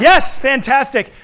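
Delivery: big drawn-out cheer; kids party magician. Acted. Cheering kids' answer.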